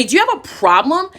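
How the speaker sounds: loud, high-pitched challenge, imitated; mock confrontational shout. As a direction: imitate her picking a fight loudly. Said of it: "like, shouting at me".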